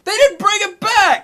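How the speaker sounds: high, drawn-out mock-outraged yell; outraged disbelief. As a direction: wail it in exaggerated disbelief at a terrible ending. Retold outrage at movie ending.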